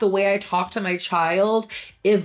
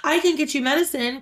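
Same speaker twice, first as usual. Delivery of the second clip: put-on a higher, eager little boy offering help; eager child; 8 semitones higher than usual. Son offering his mom medicine, higher and eager. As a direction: offer help like a small kid. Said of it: "And he's like".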